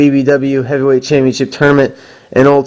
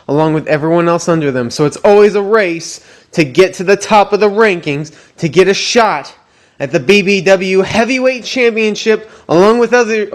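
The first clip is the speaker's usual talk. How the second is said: high, hyped ring announcer voice; wrestling promo; 6 semitones higher than usual. Acted. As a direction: sell it like a big fight night. Hyped wrap-up of the rankings.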